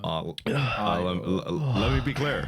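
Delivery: measured, halting presidential impression; Obama. Co-host trying a presidential catchphrase, halting impression. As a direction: attempt a statesmanlike, deliberate delivery. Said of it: "projection for my Obama".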